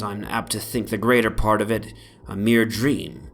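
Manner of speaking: plain reading voice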